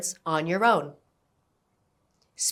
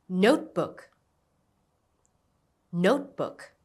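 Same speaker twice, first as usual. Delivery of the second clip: "Notebook" with the first part, Note, stressed. Shown as a demonstration. Teacher modelling a word for learners to repeat, "Notebook" stressed on Note. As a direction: lean on "Note" and let "book" fall. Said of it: "Stress on note".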